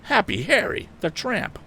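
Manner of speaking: high shocked outcry; startled cry. A narrator's reading. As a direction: cry out in recognition. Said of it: "caused him to cry out".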